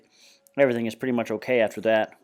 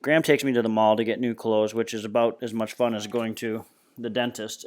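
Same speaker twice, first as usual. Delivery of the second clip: ordinary delivery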